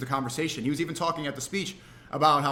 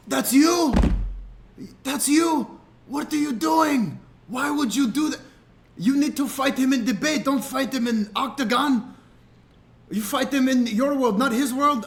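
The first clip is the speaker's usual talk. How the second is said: high-pitched, heavily stressed Dagestani coach impression; imitated astonished scolding; 9 semitones higher, 4 dB louder, slower than usual. Fighter scolding streamer over sparring, astonished imitation. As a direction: mimic an incredulous coach telling someone off.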